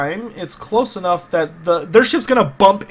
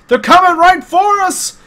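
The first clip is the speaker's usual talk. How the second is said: high, loud, drawn-out warning cry; alarmed shout; 13 semitones higher, 7 dB louder than usual. Acted. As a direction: shout it out, stretching each word.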